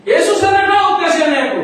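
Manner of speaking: loud, high shout for mercy; desperate cry. Acted. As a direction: cry out to Jesus over the crowd. Blind man cries out to Jesus.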